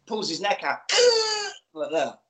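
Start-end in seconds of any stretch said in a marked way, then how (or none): 0.8-1.5 high, sharp choking gasp. Acted. Podcast host acting out a villain's death, sharp high gasp. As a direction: gasp in shock like a dying man. Choking victim gasps as neck pulled.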